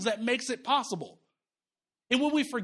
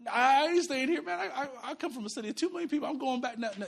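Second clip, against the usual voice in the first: ordinary talk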